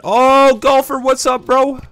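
loud, high excited yelling. Real, not acted. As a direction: shout in thrilled panic as the enemy shows up. Sudden enemy appears in game.

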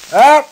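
sharp high yelp of surprise; sudden high startled cry. Real, not acted. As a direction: yelp as something jumps you. Startled in game.

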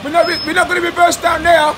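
high loud call to the driver; shouting. Real, not acted. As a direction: shout it out to the lorry.

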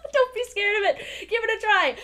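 high, loud playful plea; emphatic urging. Real, not acted. Urging viewers after laughing.

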